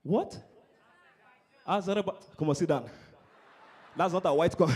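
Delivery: ordinary talk, nothing marked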